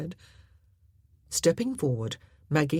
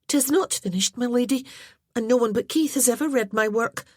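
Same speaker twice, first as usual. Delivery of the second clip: put-on higher, louder, fast flustered girl; embarrassed young lass; 8 semitones higher, 4 dB louder, faster than usual. A narrator's reading. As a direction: rush through it, cheeks burning. Aileen voiced, embarrassed.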